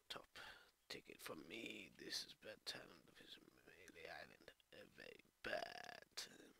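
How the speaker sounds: low, hushed lawman; dismissive sheriff, pitched well down; menacing island sheriff. Acted. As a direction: warn the newcomer off in a low voice.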